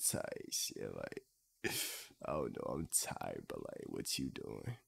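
very low, soft, sleepy murmur; fuckboy morning voice. Acted. Streamer mimicking his friend to chat, low hushed fuckboy voice. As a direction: mumble it low and barely awake. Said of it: "that boy be like".

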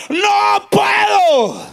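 high, long-drawn cry of refusal; retold cry. Dying woman refusing the pastor, acted drawn-out cry. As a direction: imitate her crying out, stretching each vowel. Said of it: "ella pegó un grito y dijo".

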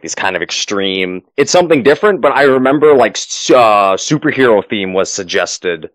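usual speaking voice

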